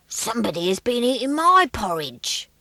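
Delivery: a big bear complaining loudly; great big growly voice. Acted. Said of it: "his great big growly voice".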